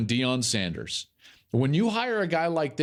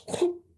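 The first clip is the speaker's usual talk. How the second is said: quick sucked-in whoop; whooshing suction noise. Pretend. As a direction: imitate something being sucked up. Imitates magnet sucking things in.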